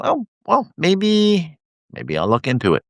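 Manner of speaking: high, casual imitation of a hesitant reader; mock shrugging reader. Imitating people who see his post, a high half-hearted shrug. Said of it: "people will look and go".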